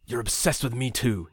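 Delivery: plain reading voice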